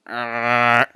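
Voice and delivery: loud imitated zombie growl; zombie groan. Storyteller voicing the homeless zombie, loud groan. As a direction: groan like the undead. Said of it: "As zombies do".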